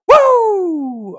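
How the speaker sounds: very high, loud woo; explosive hype whoop; loud whooping cheer. Real, not acted. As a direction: burst into a piercing whoop. Cheering the stream.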